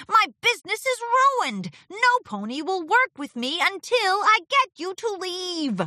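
high, aggrieved protest; frustrated complaint. A narrator's reading. Martingale with a ruined business, frustrated. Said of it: "stomped his hooves in frustration".

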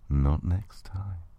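usual speaking voice

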